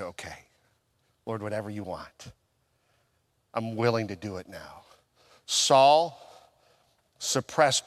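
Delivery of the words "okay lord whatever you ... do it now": soft, low prayer of acceptance; hushed and submissive; quiet surrender. Retold or imitated. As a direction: yield humbly, voice dropped right down. Retold surrender to God in prayer.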